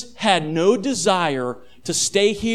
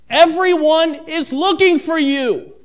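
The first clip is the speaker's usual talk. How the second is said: a touch of irritation, higher and slow; slightly annoyed; 6 semitones higher than usual. Acted. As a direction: let mild frustration creep in. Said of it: "in a tone of voice that is slightly annoyed".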